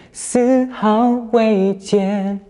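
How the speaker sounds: "丝毫未减"四字极慢，字字之间停顿; 一字一顿地唱"丝毫未减"; 带停顿. Shown as a demonstration. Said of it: "种停顿的感觉". (很慢地唱"丝毫未减"，中间有停顿)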